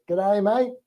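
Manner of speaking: high-pitched mock Aussie echo; kids copying Australian accent. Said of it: "all the kids say".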